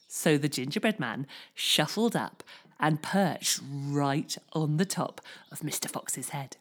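ordinary delivery, nothing marked

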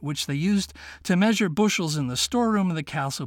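ordinary delivery

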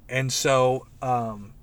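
ordinary talk

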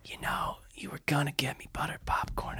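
whispered mom reminder; mom's whisper. Retold or imitated. Mom quietly reminding about popcorn.

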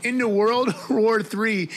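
reading through giggles, slowly; breaking up with laughter mid-sentence; laughing. Real, not acted. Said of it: "I can't keep a straight face".

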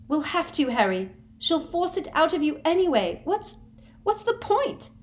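high, pleading and near tears; a girl about to cry; tearful. A narrator's reading. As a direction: Hermione begging Harry. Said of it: "began to cry".

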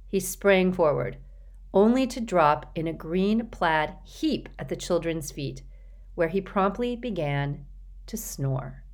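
plain reading voice

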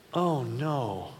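soft, sorry oh no; gently sympathize with the kid; sympathetic oh no. Shown as a demonstration. Dad commiserating with his kid, softly sorry. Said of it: "it goes like this".

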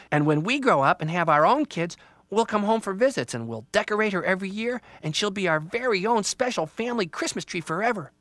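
put-on high, loud kid voice; eager child. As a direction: gush about the future like a proud child. Child imagines the future.

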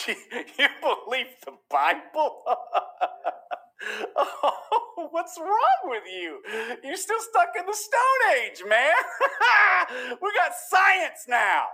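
very high, drawn-out mocking voice with laughs; hammed-up scoffer cackling at believers; scoffing.

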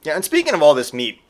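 ordinary talk

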